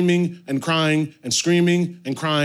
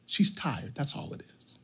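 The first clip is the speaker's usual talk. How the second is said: hushed calm reassurance; 2 semitones lower, 10 dB quieter than usual. Retold or imitated. Wife reassuring baffled husband about baby, hushed and calm. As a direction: say it quietly, trailing to almost nothing. Wife repeats her answer calmly.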